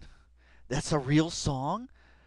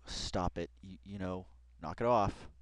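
ordinary talk

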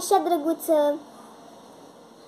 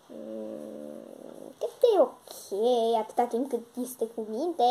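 much softer, lower sceptical friend voice; unimpressed friend; 4 semitones lower, 7 dB quieter, much slower than usual. Acted. Sunshine, low and quiet, doubts the puppy is a puppy. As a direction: sound cool and unconvinced.

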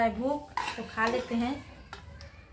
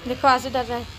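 ordinary delivery

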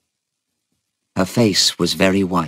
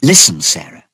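urgent, louder attention-getter; brisk, forceful opening; said quickly; 6 dB louder than usual. A narrator's reading. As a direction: get her attention, pressing. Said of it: "she said quickly".

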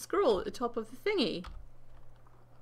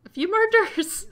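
high, loud exclamation bursting into a laugh; incredulous laughing; 11 semitones higher, 10 dB louder than usual. Real, not acted. Gamer balking at a quest's murders, incredulous laughing. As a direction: blurt it out, then laugh loudly.

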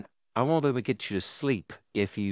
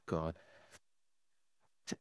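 muttered groan; 8 semitones lower, 15 dB quieter than usual. Acted. Exasperated by the kid.

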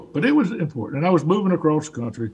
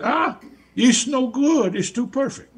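a lightly voiced old craftsman, higher and slow, scoffing; grandfather's voice; 9 semitones higher than usual. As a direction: scoff at the mass-made piece like the grandfather. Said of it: "and he looked at that and said".

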